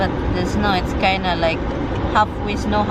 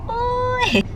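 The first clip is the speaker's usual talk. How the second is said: surprised yelp. Real, not acted. Caught by people watching.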